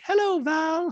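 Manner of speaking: very high, slow put-on voice; Dorit impression. Dorit praising PK in dual talking head, high put-on voice. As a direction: coo it in a squeaky voice.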